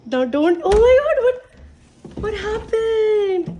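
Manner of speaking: high, very loud alarmed cries; shocked alarm. Real, not acted. Vlogger reacts to sister Isma crying, shocked loud alarm.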